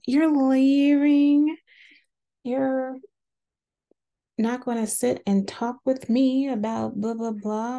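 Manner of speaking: high, drawn-out outraged retelling; mock indignant confrontation. Retelling Angie confronting Meredith as she leaves.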